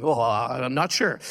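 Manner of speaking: low, drawn-out, reluctant retold reply; hesitant doubt. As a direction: stall on a long, low "oh".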